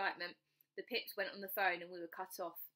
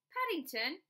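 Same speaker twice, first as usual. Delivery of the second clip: surprised; 9 semitones higher, 3 dB louder than usual. Acted. Father surprised on phone.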